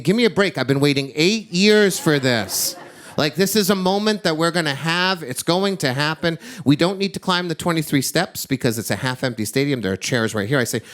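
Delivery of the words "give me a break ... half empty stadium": higher-pitched, pleading exasperation; exasperated protest. Retold or imitated. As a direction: protest like a let-down dad, pitch up. Impatient father protests at crying daughter.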